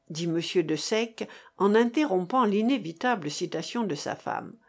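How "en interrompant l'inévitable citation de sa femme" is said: plain reading voice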